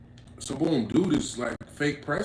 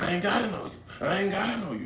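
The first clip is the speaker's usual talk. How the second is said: higher, dismissive imitation of a rude stranger; mocking the stranger; 7 semitones higher than usual. Retelling a rude stranger brushing him off, high mocking voice. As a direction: toss it off like you owe him nothing.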